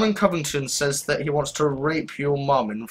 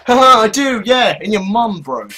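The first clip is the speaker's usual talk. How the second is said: loud mocking; 8 semitones higher, 8 dB louder than usual. Real, not acted. Mocking banter, loud laugh.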